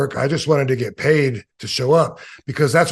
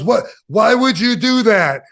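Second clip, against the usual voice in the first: mad; 7 semitones higher, 3 dB louder than usual. Retold or imitated. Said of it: "he was, like, mad".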